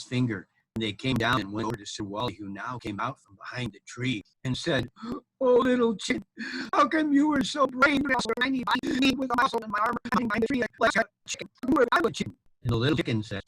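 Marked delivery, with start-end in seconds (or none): 5.4-12.4 high-pitched, hurried storybook knight; flustered knight praising a chick; amazed knight. Acted. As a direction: gush in wonder in a high put-on voice.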